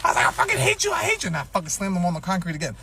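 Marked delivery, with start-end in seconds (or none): 0.3-1.3 furious yell. Retold or imitated. Kid pounding the bully on the ground, high angry yell. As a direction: scream your hatred while punching.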